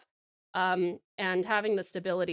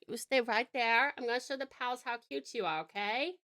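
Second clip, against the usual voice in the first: normal talking voice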